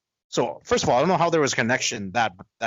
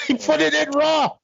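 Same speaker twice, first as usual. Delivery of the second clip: high, laughing blurt; 4 dB louder than usual. Real, not acted. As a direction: burst out laughing as you say it. Laughing reaction to the story.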